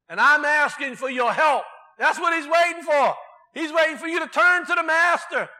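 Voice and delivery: loud, high, driving preacher's cry; full-force preaching. Acted. Pastor urging the congregation to humble themselves, preaching loud and high.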